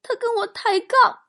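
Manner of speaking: very high, squeezed tearful wail; mock sobbing. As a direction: wail the sorry tale, climbing to a loud peak. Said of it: "哽咽着说". (夸张的哽咽哭诉，越说越响)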